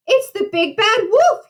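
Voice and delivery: high-pitched storybook alarm; alarmed cry. A narrator's reading. Percy sees the wolf's terrible teeth, high alarmed cry. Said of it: "he cried".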